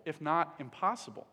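ordinary delivery

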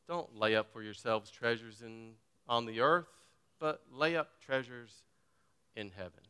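soft, lilting mock-poetic reading; sing-song poetical tone. Shown as a demonstration. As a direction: read it like a pretty poem. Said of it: "this little sing-song, poetical tone".

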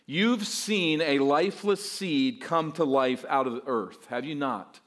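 ordinary talk, nothing marked

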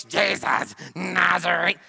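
loud, rough demon growl; demonic voice. Acted. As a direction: roar it like a possessed man breaking into worship. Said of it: "speaks up with a demonic voice".

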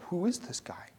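hushed, puzzled whisper. Pretend. Preacher wonders about the stranger, in a whisper. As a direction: ask it under your breath. Astonished aside in the story.

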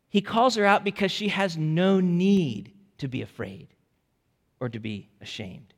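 ordinary talk

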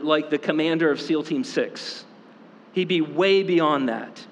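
ordinary talk

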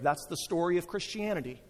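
normal talking voice

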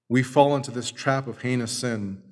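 ordinary talk, nothing marked

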